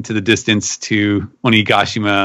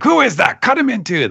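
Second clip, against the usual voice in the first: mock angry samurai; 14 semitones higher than usual. Samurai below furious at Luffy, hammed-up high yell. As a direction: bellow for his head in a cartoon fury. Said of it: "super pissed off at it".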